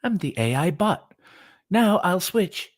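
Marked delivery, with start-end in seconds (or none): none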